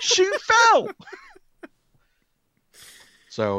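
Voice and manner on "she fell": squealed through laughter, very high; laughing protest. Retold or imitated. Defending himself online, laughing high squeal. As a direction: protest while cracking up. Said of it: "I'm like".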